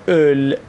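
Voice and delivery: اول with rounded lips and a drawn-out ö; rounded o-umlaut. Shown as a demonstration. Teacher shows Iranian learners a hard vowel, اول with long rounded ö.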